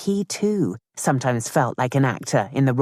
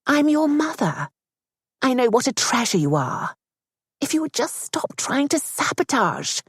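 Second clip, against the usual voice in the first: a much higher, earnest mother's voice; 9 semitones higher than usual. Acted. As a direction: plead lovingly but firmly. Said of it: "Mother's tone was earnest".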